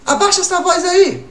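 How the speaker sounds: high, mock scolding mother's call; scolding mom. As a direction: call out like a mom telling her kid to keep it down. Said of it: "a sua mãe fala assim". (mãe bronqueando, aguda e meio caricata)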